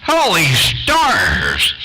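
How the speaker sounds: high, slow exclaim; radio shout. Real, not acted. On the radio calling out to friends, slow high exclaim. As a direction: stretch it out in wonder.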